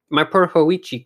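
a lightly voiced priest, startled at the sight; startled cry. A narrator's reading. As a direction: call out to him in shock. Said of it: "cried the startled priest".

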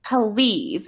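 "Please" stretched into two syllables, puh-LEEZ; Valley Girl "Please" with an added vowel after the P. Shown as a demonstration. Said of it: "please was two syllables".

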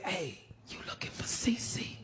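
hushed, furtive approach; secretive whisper. Retold or imitated. Stranger approaches the searching inmate, whispering. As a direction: whisper it like a shady go-between.

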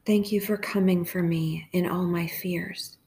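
hushed, even prayer with long pauses; guided meditation prayer. Real, not acted.

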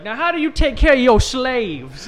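high, loud Cartman Southern voice; Cartman impression. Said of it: "Cartman when he's like".

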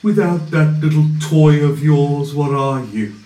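scornful villain. Acted. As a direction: belittle the boy wizard, then go cold. GM voices the villain.

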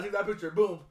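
usual speaking voice

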